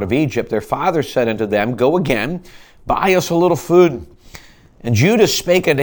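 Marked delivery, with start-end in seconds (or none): 1.8-4.1 a touch raised and louder; Jacob's request. Acted. Jacob tells his sons to go back, lightly pleading. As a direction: lift the father's plea slightly. Father Jacob voiced in scripture.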